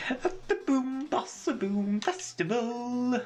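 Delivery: high, drawn-out festival announcement; slow, showy high voice; theatrical verse recital. Acted. Echoing the riddle's grand verse.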